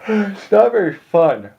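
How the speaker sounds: talking through a big yawn. Real, not acted. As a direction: say it mid-yawn, stretched out. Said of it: "I'll apologize for yawning".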